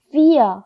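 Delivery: fear ending in a full "a", drawn out; wrong ending. Shown as a demonstration. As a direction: stretch fear into "fee-ya". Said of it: "도 아니고요".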